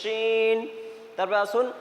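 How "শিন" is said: শিন opened with a long heavy "sh"; strong whistle. Shown as a demonstration. Teacher repeats the model, শিন strongly whistled. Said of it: "বেশি করে সিটি দিয়ে পড়তে হবে".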